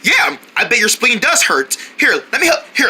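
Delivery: ordinary talk, nothing marked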